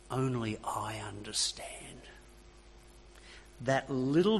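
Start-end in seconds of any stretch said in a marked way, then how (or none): 0.1-2.0 breathy whisper, soft and intimate; a tempter whispering in the ear. Pretend. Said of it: "the little voice goes something like this".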